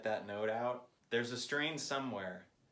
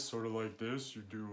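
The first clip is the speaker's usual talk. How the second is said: slack-jawed dopey voice, larynx dropped. Shown as a demonstration. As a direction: go dopey and dumb, jaw hanging. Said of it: "if you do kind of a dopey voice".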